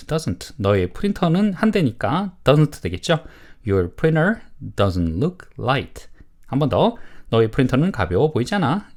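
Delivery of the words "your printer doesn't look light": slow, word-by-word model statement; model reading. Shown as a demonstration. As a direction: read slowly for learners to repeat. Teacher models sentence for repetition. (천천히 또박또박 읽는 시범)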